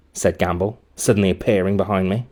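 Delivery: ordinary delivery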